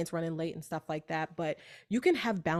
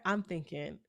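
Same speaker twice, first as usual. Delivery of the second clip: ordinary talk, nothing marked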